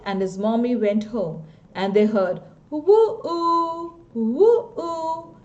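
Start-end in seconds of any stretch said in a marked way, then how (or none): 2.7-5.4 long high hooting woo-oo like a train whistle; a child's choo-choo train whistle, drawn out. Retold or imitated. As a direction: stretch it out slowly like a distant train. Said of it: "the choo-choo train".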